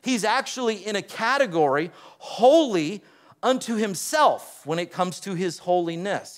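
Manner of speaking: ordinary talk, nothing marked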